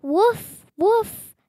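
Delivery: high, bright little yips of a puppy; puppy woof. Acted. Reading a picture book aloud, puppy Biscuit barks with high yips. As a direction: yap it like a happy puppy.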